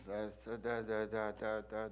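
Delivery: low, hushed mock-German speech; soft, low nonsense syllables in an even run; start slow and low. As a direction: open a mock oration very quietly. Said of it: "He would start off slow. Talking low".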